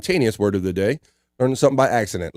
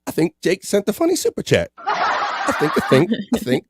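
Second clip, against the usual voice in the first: laughing; 6 semitones higher than usual. Real, not acted. Laughing about the super chat.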